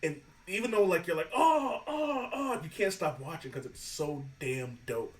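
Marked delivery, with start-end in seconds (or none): 1.3-2.6 high, mock-squeamish "oh" cries; creeped-out groans. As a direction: react squeamishly to a creepy contortionist. Acts out reaction to creepy contortionist.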